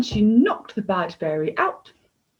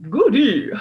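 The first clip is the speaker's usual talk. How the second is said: high, loud delighted cry; gleeful king; 8 semitones higher, 8 dB louder than usual. A narrator's reading. King cheering that his plan works, loud delighted cry. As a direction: squeal it like a greedy child. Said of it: "cried the king".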